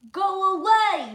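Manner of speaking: loud, high, slow "go away"; bossy dismissal. Acted. George sending Rashida away, loud and high. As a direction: shoo her off.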